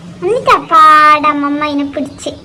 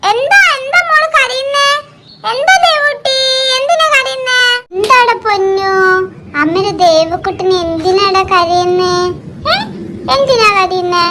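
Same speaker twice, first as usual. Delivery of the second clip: soothing coo; 6 semitones higher than usual. Acted. Mother comforting her crying little daughter, high cooing. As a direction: coax a crying toddler gently.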